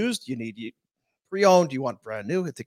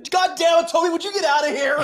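fed-up boss impression; 10 semitones higher than usual. Boss yelling at Toby to get out, mock furious. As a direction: throw the annoying coworker out of the room. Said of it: "what's his name on The Office?".

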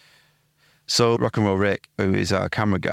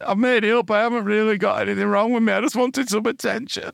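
high, whiny sobbing confession; mock tearful granddad; 11 semitones higher than usual. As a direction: whine it out like a weepy old man faking tears. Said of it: "burst into tears and went".

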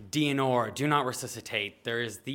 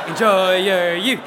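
loud, slow, higher-pitched cry; big drawn-out imitation of a patient; voiced patient's refrain, louder; 5 semitones higher, 11 dB louder, slower than usual. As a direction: belt it out, stretching each word. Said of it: "Mary Legolas is like".

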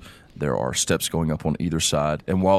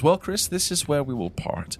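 higher, polite farewell; Thea parting; 9 semitones higher than usual. Acted. Thea bids Chris farewell, polite. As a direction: leave him with the gnome. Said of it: "she turns to you and she says".

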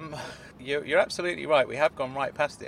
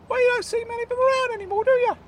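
high, fast squeaky protest; high-pitched indignant character voice; high Claude voice; 5 dB louder, faster than usual. Acted. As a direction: argue the point in a high piping voice. Claude defending Wimpy.

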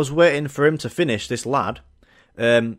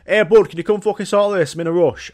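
mock shout; 4 semitones higher, 5 dB louder than usual.

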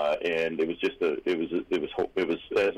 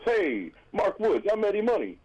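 high, slow New York accent imitation; 14 semitones higher than usual. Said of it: "And it's like New York".

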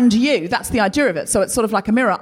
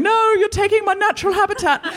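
little elephant voice; 10 semitones higher than usual. Retold or imitated. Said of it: "the little elephants down there going".

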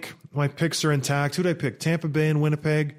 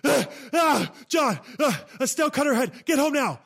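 high, breathless panicked gasping; panicked wife gasping out an emergency call; frantic panic; 11 semitones higher than usual. Retold or imitated. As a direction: imitate a wife in a panic on the phone. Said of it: "who is absolutely frantic".

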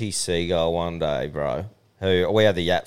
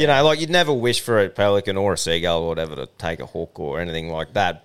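ordinary talk

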